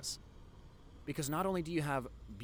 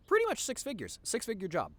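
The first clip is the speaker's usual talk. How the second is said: excited; 9 semitones higher, 5 dB louder than usual. Real, not acted. Hyping the pay.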